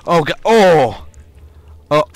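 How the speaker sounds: sudden high loud fright; startled cry. Real, not acted. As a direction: jump scare yelp. Startled by monster in game.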